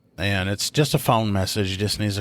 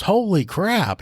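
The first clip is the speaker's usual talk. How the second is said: shocked outburst; 11 semitones higher, slower than usual. Retold or imitated. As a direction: blurt it out stunned. Rookie reacts to bill.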